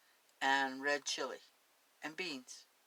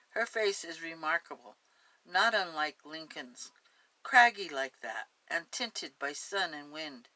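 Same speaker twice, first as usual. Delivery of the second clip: story narration; 2 semitones higher, 6 dB louder than usual. Acted. Reads the story aloud.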